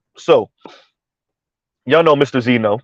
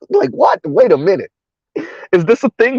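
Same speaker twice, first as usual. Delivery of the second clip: mock bewilderment; 7 semitones higher than usual. Acts out past bewildered reaction.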